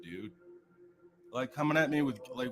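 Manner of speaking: usual speaking voice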